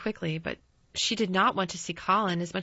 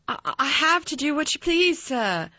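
high, louder, stammering servant's answer; faltering; 8 semitones higher, 5 dB louder than usual. A narrator's reading. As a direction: stumble nervously on the first word, pitched well up. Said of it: "Martha faltered".

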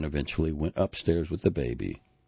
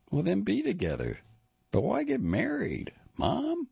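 son's voice climbing much higher in disbelief; rising upset son. A narrator's reading. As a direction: push higher with each question. Son Christopher voiced in story.

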